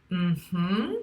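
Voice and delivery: closed-mouth rising "mm-hmm"; allowing mm-hmm. Shown as a demonstration. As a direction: demonstrate an accepting hum, rising at the end. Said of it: "the sound that this makes is".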